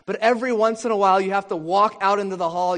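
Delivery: preacher's rising, excited emphasis; emphatic build. Real, not acted. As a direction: drive the turn home with a lift.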